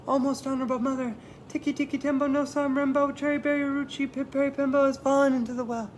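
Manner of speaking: high and fast with urgency; urgent child. Acted. Chang tells his mother, high fast urgent child. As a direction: rush it out like a frantic little boy.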